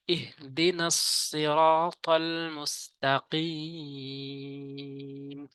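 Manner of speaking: slow, drawn-out chanted recitation; Quran recitation. Shown as a demonstration. Teacher recites the verse for viewers, slow and chanted. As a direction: stretch each long vowel like tajweed. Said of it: "শক্ত করে উচ্চারণ করতে হবে".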